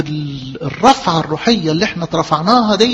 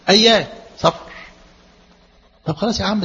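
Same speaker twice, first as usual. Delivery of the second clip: usual speaking voice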